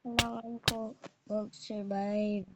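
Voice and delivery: ordinary talk, nothing marked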